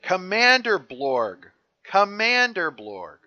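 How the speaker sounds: high, loud, drawn-out yell; mock scream. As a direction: yell it slowly in frustration at a screen. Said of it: "Luke screams at the screen".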